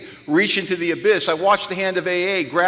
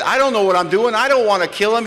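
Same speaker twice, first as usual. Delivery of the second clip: louder, higher panicked yell; yelling after him; 7 semitones higher, 6 dB louder than usual. Retold or imitated. At the sponsor's back, a panicked louder yell. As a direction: shout it after someone walking away. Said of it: "I yelled at the back of his bald head".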